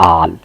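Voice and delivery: drawn-out aa in "आल"; long aa. Shown as a demonstration. Teacher showing double-A spelling, long aa pronunciation. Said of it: "इसका तलफ़ुज़ हुआ".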